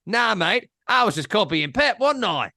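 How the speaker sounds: high-pitched mock Australian accent. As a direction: put on a matey Australian accent. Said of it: "That's Australian, that accent".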